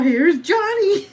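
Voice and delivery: loud, slow, laughing horror-movie growl; Here's Johnny. Retold or imitated. Telling viewers how he busts through the door, slow loud menacing cry. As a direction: bust in like the axe killer. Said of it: "He busts through your door".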